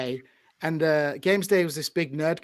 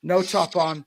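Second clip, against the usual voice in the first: ordinary talk, nothing marked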